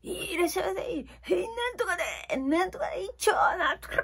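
high, brash imitation of a shouting clerk; mock izakaya hollering; loud clerk call. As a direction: bellow the orders like a busy counter cook. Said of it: "そんなに大きな声でね".

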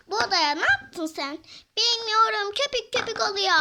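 a hammed-up panicked outburst; loud panic. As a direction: shout it in a panic. Scolding, panicked reply.

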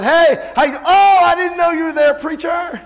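high, loud mock-surprised greeting; feigned surprise. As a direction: exclaim as if caught avoiding the preacher. Mimics hider caught at Walmart.

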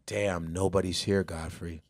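low, soft, deflated mutter; a hushed mock lament to himself; imagined sad aside. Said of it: "Where it was never like".

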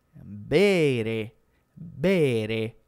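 "bere" slow and low, long first vowel; pronunciation model. Shown as a demonstration. Teacher names the verb for learners, slow deliberate "bere". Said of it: "Questo è il verbo".

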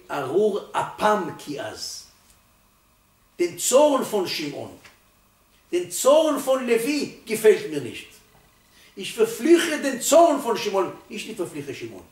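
solemn thundering proclamation; proclaiming scripture. Acted. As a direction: declaim the verse like a verdict. Proclaims the verse.

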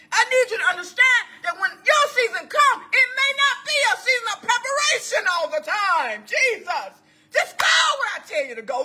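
high, driving pulpit cry; full-force preaching. Acted. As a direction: exhort the congregation with urgent commands.

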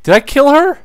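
very high, loud shocked cry; shocked outburst. Real, not acted. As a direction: yelp in total surprise. Target died unexpectedly.

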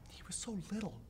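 high, choked near-whisper; breaking down, barely voiced; grief-stricken. Grieving a child in monologue.

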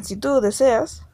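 ordinary talk, nothing marked

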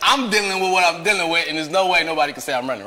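loud, high protest with laughter; indignant denial. Retold or imitated. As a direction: laugh while insisting you did nothing wrong. Acts out indignant inner denial.

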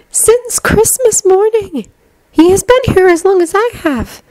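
put-on high, slow character voice for a girl; Luna, composed. A narrator's reading. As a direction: admonish slowly in a high-pitched voice.

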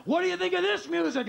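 teasing women. Retold or imitated. Makeup women teasing him.

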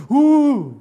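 sudden very high, very loud cry; alarming grunt. Shown as a demonstration. Teacher shows the same grunt turned into alarm. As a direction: bark it as a warning. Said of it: "O te puede decir".

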